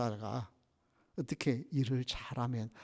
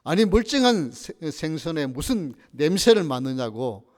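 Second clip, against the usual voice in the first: angry outburst; 2 semitones higher, 8 dB louder than usual. Acted. Fish seller yelling at a customer sniffing his fish, angry. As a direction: snap loudly as an offended shopkeeper. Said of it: "소리를 버럭 지릅니다".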